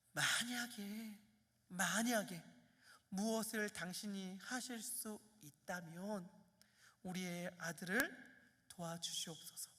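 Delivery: soft, slow, humble plea; hushed pleading prayer. Acted. Desperate father quietly begging Jesus to help his son. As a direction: beg quietly for your son's life.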